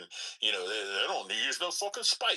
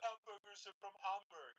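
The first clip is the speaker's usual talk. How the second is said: high put-on retort; mock young European actor; 10 semitones higher, 17 dB quieter than usual. Man imitating Spider-Man actor correcting Americans, high mocking voice. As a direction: pitch it up to mimic his correction. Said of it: "and he's like".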